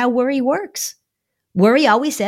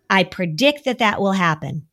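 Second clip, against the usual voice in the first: ordinary talk, nothing marked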